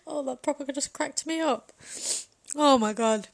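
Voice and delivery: loud laugh spilling into the words. Real, not acted. Still cracking up at her own joke, laughing. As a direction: talk through the giggles.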